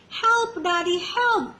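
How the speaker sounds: high, alarmed little cry for help. Acted. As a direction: call out to Daddy, stuck. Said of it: "cried Little Squirrel".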